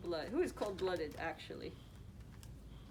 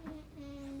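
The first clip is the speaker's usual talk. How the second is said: plain reading voice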